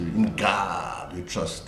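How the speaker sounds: "God" with an open, opened-out vowel, said slowly; slow American "In God we trust" with open vowels; American pronunciation. Shown as a demonstration. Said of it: "tendency of opening them out".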